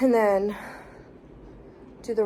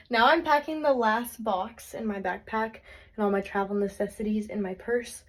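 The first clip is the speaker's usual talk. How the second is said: ordinary talk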